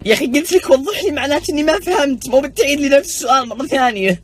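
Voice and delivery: angry complaint. Acted. Student furious that the teacher repeated the question, exasperated rant. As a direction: scold the teacher in frustration.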